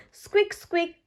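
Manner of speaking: high little squeak squeak; rabbit squeak. A narrator's reading. Rebecca Rabbit squeaking on the way to the ducks. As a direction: squeak like an excited bunny. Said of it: "cries Rebecca Rabbit".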